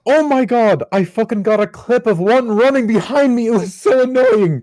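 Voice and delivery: loud, high-pitched annoyance; high, worked-up venting; exasperated outburst. Real, not acted. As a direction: burst out, aggrieved and loud. Exasperated outburst about the mice.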